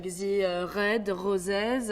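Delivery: "red roses" drawn out with strong throaty French R's. Shown as a demonstration. Showing a first instinct, strong French R in "red roses". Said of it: "doing a very strong R to sound French".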